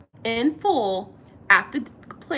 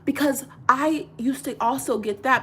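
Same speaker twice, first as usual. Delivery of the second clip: usual speaking voice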